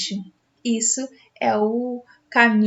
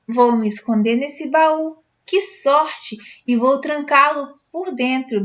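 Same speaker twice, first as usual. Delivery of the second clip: ordinary delivery, nothing marked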